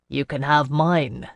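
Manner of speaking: dry, understated retort in a man's voice; a touch of wry humour. A narrator's reading. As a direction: hand it over with mild irony. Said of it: "Jocelyn said wryly".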